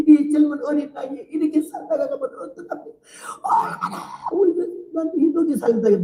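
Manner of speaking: high, crying plea in Jeolla dialect; tearful pleading prayer. Retold or imitated. 권사 praying over the dying narrator, weeping Jeolla dialect plea. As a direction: beg God through tears, voice breaking high. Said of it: "울면서 기도를 하더니".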